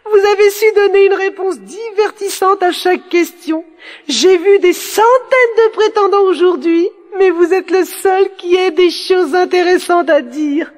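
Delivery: very high-pitched, gushing princess; delighted princess. Acted. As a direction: gush to the winning suitor in a high voice. Delighted princess offers her hand. (princesse ravie, voix très aiguë)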